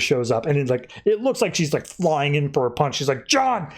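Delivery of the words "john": calling out. Retold or imitated. Huntress calling out.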